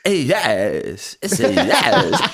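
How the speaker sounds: drawn-out, oddly pitched celebrity impression; Christopher Walken. Showing which actor moment he means, drawn-out Walken impression. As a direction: stretch the words, pitch jumping up. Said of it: "I know exactly what, what Christopher Walken you talking about".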